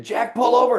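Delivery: high, urgent retold shout; retold angry cursing. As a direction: imitate an alarmed passenger cursing at the driver. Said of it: "he started cursing at me and saying".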